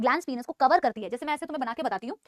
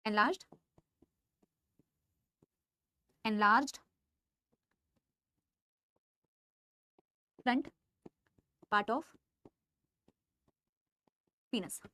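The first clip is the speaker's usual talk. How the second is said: slow dictation; 6 semitones lower, 7 dB quieter than usual. Shown as a demonstration. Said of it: "इसे लिखो जरा".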